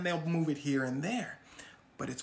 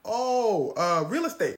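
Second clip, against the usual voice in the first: caught-out excuse; 3 semitones higher, 8 dB louder, slower than usual. Retold or imitated. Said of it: "Hush Puppy said".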